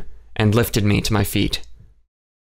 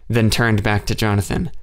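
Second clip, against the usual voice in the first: ordinary delivery, nothing marked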